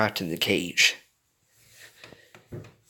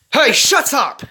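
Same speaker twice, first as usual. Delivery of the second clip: loud, high snap at the fox; angry shout; 8 dB louder, slower than usual. A narrator's reading. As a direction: shut the cruel fox up. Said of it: "Plucky shouted at the fox".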